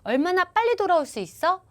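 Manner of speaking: 빨리 lifted high, with a rising end; stress on 빨리. Retold or imitated. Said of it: "이렇게 물어봤을 때".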